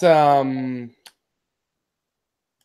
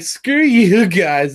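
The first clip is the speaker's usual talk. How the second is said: loud, high, drawn-out comeback; defiant mock yell at the critics; defiant retort; 6 semitones higher, 6 dB louder, much slower than usual. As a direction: push it out loud and slow. Acted retort to forum critics.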